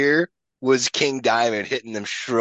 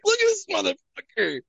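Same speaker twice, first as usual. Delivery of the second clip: much higher, goofy self-imitation showing off; mock proud. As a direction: squeak it like a proud kid. Showing the tooth to friends.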